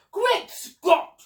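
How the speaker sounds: high, loud exclamation; astonished gasp. A narrator's reading. Duke staggered by the cherry offering, astonished gasp. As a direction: burst out in shock. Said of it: "he gasped".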